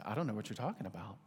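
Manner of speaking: put-on quick, soft mumbled denial of a small boy; a child brushing off his dad, quick and soft; son's denial. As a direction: mutter it fast like a guilty kid. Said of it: "He said".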